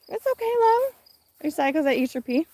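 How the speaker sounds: calm the little one after the pea. Real, not acted. Mom soothing her upset baby before the garden tour, high baby talk.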